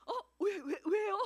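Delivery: 크게 놀라며 웃는 아내; flustered laugh of surprise; startled. Retold or imitated. Wife startled by husband. (갑자기 화내는 남편에 놀란 아내)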